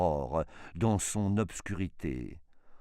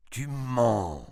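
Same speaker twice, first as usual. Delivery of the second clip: louder, higher accusing outburst; angry retort; 5 semitones higher, 5 dB louder than usual. A narrator's reading. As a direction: throw it back in theatrical anger. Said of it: "avec colère". (réplique déclamée avec colère)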